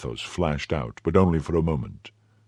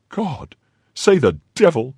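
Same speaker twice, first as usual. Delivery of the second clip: dismayed outcry; 9 semitones higher, 4 dB louder than usual. Acted. Innkeeper cries out in dismay.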